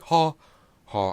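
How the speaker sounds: high, loud chanted "ha"; crowd chant. Retold or imitated. Reviewer mimicking the song's triumphant vocals, loud crowd chant. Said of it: "crowd chants".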